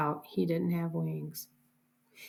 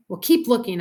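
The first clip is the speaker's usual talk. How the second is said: louder, higher impatient order; yelled order; 4 semitones higher, 7 dB louder than usual. A narrator's reading. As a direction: order her to keep at it. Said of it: "I yelled".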